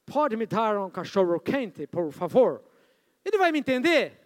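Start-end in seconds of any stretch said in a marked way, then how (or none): 0.1-2.6 put-on slow, lower Portuguese with a Canadian accent, English-style r; careful learner asking in a Canadian accent; mock Canadian accent, slowly. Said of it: "é assim que o John fala".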